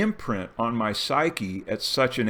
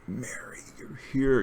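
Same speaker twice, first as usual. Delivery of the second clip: much softer, awed welcome; hushed ecstatic greeting; 2 semitones higher, 5 dB quieter than usual. Retold or imitated. Dreamer greeting Mary in the dream, hushed and ecstatic. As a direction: breathe it out in rapt joy. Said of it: "you know, like this".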